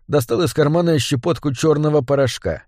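ordinary delivery

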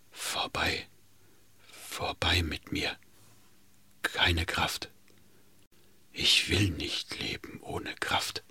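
faint rattling whisper, barely breath; death rattle. Acted. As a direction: breathe the words out like a dying man. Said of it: "Ganz leise, es war wie ein Atem bloß, röchelte Händel".

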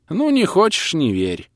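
starts high, drops in let-down; a touch of disappointed huff. A narrator's reading. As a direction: give up on convincing him, mildly miffed. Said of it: "разочарованно отозвался сосед".

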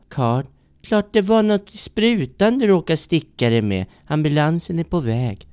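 a hint of an exasperated girlfriend; lightly voiced Maud. Maud telling Kurt the ambulance is coming, lightly voiced.

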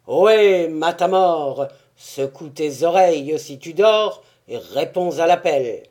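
loud hailing call across snow. Acted. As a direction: shout to wake a sleeper. Tyrant calls out loudly.

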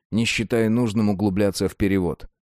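ordinary delivery

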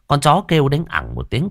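plain reading voice

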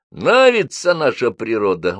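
somewhat menacing. A narrator's reading. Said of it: "несколько даже грозно".

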